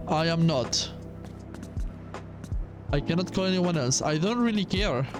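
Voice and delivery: ordinary delivery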